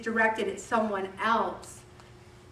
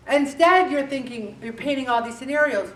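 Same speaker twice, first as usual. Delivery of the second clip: ordinary talk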